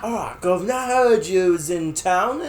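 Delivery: hammed-up Cockney geezer; Cockney accent. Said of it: "why can't you do a British accent".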